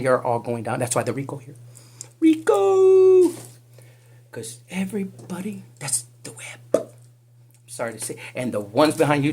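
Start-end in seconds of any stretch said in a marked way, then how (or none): 2.2-3.3 much higher, loud triumphant call; loud sing-out of the word; shouted Rico. Acted. Calling out RICO. 4.3-6.9 whisper. Acted. Secretive aside.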